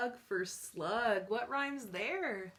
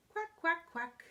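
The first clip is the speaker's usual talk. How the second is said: high, short quacks; happy duckling quack; 6 semitones higher, 3 dB louder than usual. Acted. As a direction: quack lightly like a happy little duck. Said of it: "When I'm feeling happy, I say".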